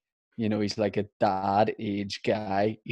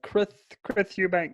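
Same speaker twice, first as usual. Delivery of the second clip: Chris Eubank impression; 8 semitones higher than usual. Shown as a demonstration. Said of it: "my impression of Chris Eubank".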